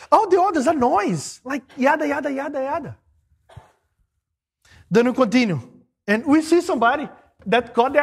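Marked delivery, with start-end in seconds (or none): 0.1-3.0 higher, mocking yada yada yada; dismissive yada yada. Real, not acted. Preacher waving off other opinions, mocking and dismissive. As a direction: brush it off as noise.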